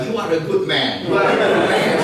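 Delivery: a touched elder repeating praise; imitating the pleased elder. Elder's reply voiced.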